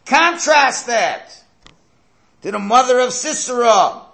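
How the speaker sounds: high, drawn-out preacher's shout; emphatic shout. Real, not acted. Preacher turns to Sisera's mother, emphatic shout. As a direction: cry out the contrast at full voice. Preacher builds a dramatic contrast.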